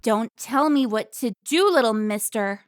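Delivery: loud, scolding put-down; angry snap. Acted. Carmen scolding Alfonso like a child, loud. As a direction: put him in his place.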